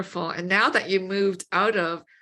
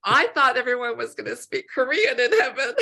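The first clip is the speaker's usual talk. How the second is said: laughing; 5 semitones higher, 4 dB louder than usual. Real, not acted. Joking back, still laughing.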